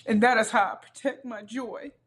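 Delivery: high, tearful voice full of love; crying. Real, not acted. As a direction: voice rising as real tears come. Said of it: "Sorry, I'm crying".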